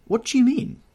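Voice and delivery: very high, loud demand; alarmed question. A narrator's reading. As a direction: voice leaps up in sudden alarm. Alarmed question at ominous news.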